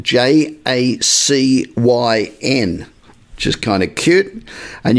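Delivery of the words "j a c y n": J A C Y N spoken slowly, letter by letter; spelling it out. Shown as a demonstration. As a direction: spell "J A C Y N" one letter at a time. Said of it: "Jason's name is spelt".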